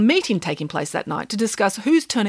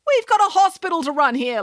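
lecturing; 9 semitones higher than usual. A narrator's reading. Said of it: "lectured the doctor".

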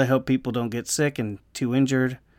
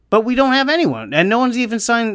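exasperated rant; 9 semitones higher, 3 dB louder than usual. Real, not acted. Rant about unsigned players.

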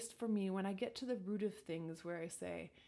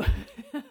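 short loud rising laugh; 8 semitones higher, 14 dB louder than usual. Real, not acted. Laughs at talking to herself.

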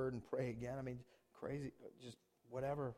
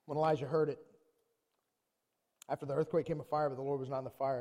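ordinary talk

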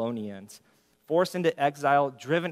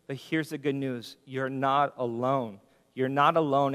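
usual speaking voice

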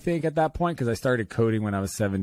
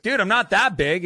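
defensive denial; 10 semitones higher, 4 dB louder than usual. Retold or imitated. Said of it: "I was like".

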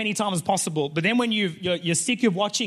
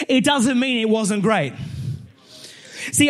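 higher, louder preaching build; full-force preaching; 9 semitones higher, 4 dB louder, slower than usual. Acted. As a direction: rouse the congregation.

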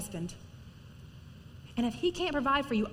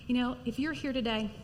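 ordinary delivery

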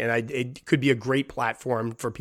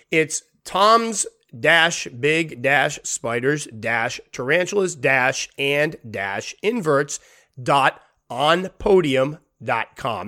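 slow dictation; much slower than usual. Real, not acted. Spelling out the website address.

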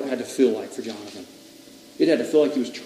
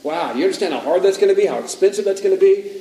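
loud, higher, pressing warning; alarmed naysayers; 3 semitones higher, 6 dB louder, faster than usual. Acted. Doubting leaders warning a preacher off India, alarmed. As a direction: sound loudly daunted by the task.

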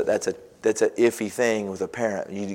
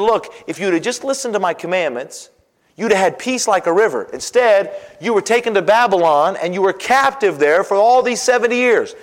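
loud, higher, rising preaching; fiery preacher reading scripture; 9 semitones higher, 7 dB louder than usual. Acted. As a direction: proclaim it to the ends of the earth. Preaching at full force.

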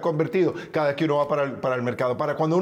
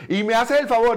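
higher, louder nagging wife; nagging woman; 6 semitones higher, 5 dB louder than usual. Retold or imitated. Imitating a quarrelsome wife, higher and louder. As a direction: mimic a quarrelsome woman's scolding. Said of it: "como las mujeres peleonas esas que dicen".

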